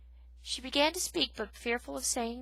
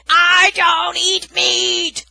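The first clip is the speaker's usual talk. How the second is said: very loud, very slow shout; screaming; 10 semitones higher, 20 dB louder, much slower than usual. Acted. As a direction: yell each word slowly. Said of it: "Aerith screamed".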